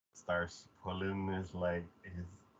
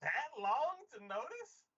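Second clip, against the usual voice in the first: high, drawn-out incredulous question; mock disbelief; 13 semitones higher than usual. Viewer reacting to slow brother, mock disbelief. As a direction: stretch it out in exaggerated amazement. Exasperated reaction to the retold scene.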